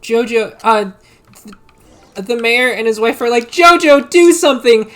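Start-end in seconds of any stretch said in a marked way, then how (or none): 3.5-4.9 high, loud acted plea; urgent yell. Mayor and wife urge Jojo at the last second, yelling. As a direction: yell it like a desperate parent, hammed-up.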